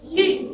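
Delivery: ई read off quickly, a short 'i'; short I sound. Shown as a demonstration. Teacher explains small ई is read fast, quick short ई. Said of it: "तो जल्दी पढ़ी जाएगी".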